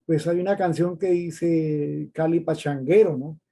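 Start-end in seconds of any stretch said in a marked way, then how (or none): none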